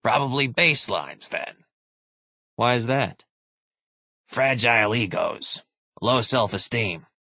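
put-on dry, lightly amused old soldier; a hint of a wry veteran; grizzled veteran. Moore voiced in narration.

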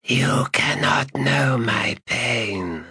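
slow, high, whispered hiss; a dead dragon hissing inside your skull; sibilant dragon voice. Acted. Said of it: "The dragon's sibilant voice".

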